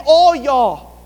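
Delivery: pointing at the whole crowd; emphatic quote. Retold or imitated. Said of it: "No, he's saying".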